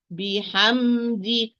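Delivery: بحمد drawn out, each letter given its time; slow, given its time. Shown as a demonstration. Teacher models unhurried بحمد. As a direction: recite بحمد slowly, no rushing. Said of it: "So give it its time".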